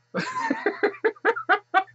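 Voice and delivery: high squealing burst of laughter. Real, not acted. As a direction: cackle up high with delight. Laughing at embarrassing baby pictures.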